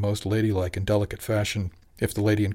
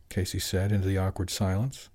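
ordinary delivery

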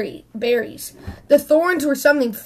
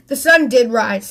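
usual speaking voice

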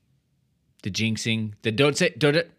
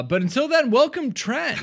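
much higher, louder upbeat welcome; cheery Ted; 6 dB louder than usual. Retold or imitated. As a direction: chirp it like a relentlessly upbeat coach. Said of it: "And then Ted says".

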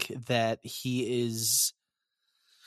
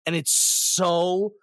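ordinary talk

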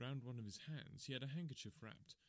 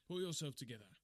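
firm command; 3 semitones higher, 5 dB louder than usual. A narrator's reading. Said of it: "I cried".

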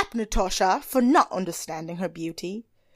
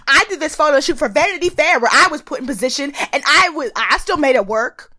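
bragging Tyra impression; 5 semitones higher, 7 dB louder than usual.

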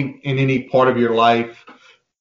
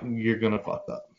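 much softer, dry deadpan verdict; blunt low warning; 3 semitones lower, 10 dB quieter than usual. Retold or imitated. Telling the student off, quiet deadpan warning. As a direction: drop it low and flat-out blunt. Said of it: "I'm like".

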